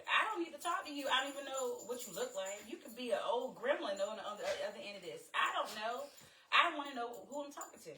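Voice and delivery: fed-up rant about a faceless stranger; irritated rant. Real, not acted. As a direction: vent the irritation, pitch climbing. Ranting in irritation.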